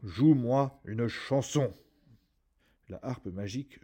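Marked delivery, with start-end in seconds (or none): 0.0-1.7 very slow, louder giant voice; ogre ordering. A narrator's reading. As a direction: command the harp to play. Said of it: "dit l'ogre".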